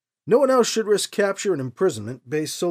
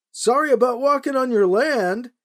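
higher, louder apology called downhill; shouting apology; 8 semitones higher, 4 dB louder than usual. A narrator's reading. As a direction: shout a sheepish sorry across the trail. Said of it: "he shouted".

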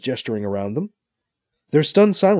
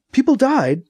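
high, alarmed question; worried, sharply raised pitch; suddenly worried; 12 semitones higher, 4 dB louder than usual. A narrator's reading. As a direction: ask in sudden worry. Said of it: "suddenly worried".

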